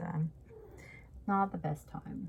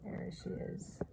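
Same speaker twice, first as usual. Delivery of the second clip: whispering; 5 dB quieter than usual. Real, not acted. Whispering about the cat.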